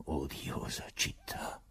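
slow, soft, whispered grumble; muttered. Acted. Procurator grumbling about the city to himself, slow whispered mutter. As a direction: mutter it to yourself in disgust. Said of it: "borbottò a un tratto il procuratore".

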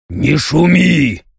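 slow, annoyed growling command; irritated growl. Acted. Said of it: "раздражённо рыкнул император".

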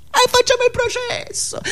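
leaps high in a sarcastic challenge; mocking dare. Comedian taunting the audience about a trial, sarcastic high jeer.

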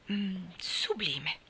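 slow, whispered relish; savouring. A narrator's reading. Anna to Marilla recalling the ice cream, slow whispered relish. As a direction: linger on sublime as if tasting it.

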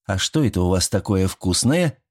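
ordinary delivery

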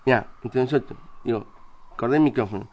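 low, hushed aside between men; off-the-record murmur; confidential. Acted.

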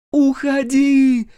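shrill frantic scream; desperate scream. A narrator's reading. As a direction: scream for the boy to run. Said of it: "девкин истошный крик".

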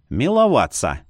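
plain reading voice

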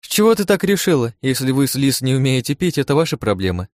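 high-pitched indignant comeback; heated, starts high and eases down; defensive retort. Acted. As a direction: throw the accusation back at her. Acted quarrel, defends herself.

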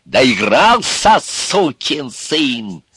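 громко, высоко и очень протяжно; прикрикнуть, растягивая каждое слово; сердитый крик. A narrator's reading. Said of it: "закричал он на Ивана". (гневно закричать)

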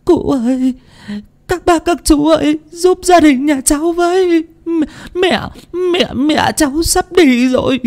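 high, tearful wailing voice of a daughter; sobbing plea. A narrator's reading. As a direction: beg for help through sobs. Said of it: "nước mắt nước mũi tèm lem, mếu máo". (giọng mếu máo nức nở)

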